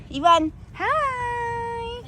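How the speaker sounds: high, very slow sing-song hello; drawn-out greeting. Real, not acted. Greeting little Ivan, drawn out high and slow.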